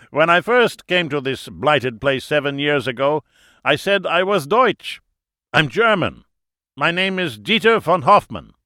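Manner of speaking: put-on high, loud German-accented voice; German host, jovial. A narrator's reading. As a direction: introduce yourself with booming German pride. German character introduces himself.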